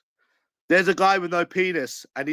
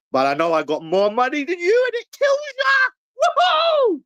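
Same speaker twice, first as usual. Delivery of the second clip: loud gloating shout, much higher; 4 dB louder than usual. Real, not acted. Crowing at his rival, a high gloating shout. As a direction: yell it in his face, triumphant.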